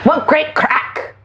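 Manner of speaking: slow attempt at an Irish accent; badly faked Irish accent, drawn out. Shown as a demonstration. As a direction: have a slow go at sounding Irish. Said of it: "Can you do Irish?".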